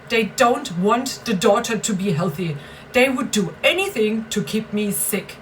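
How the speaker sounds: normal talking voice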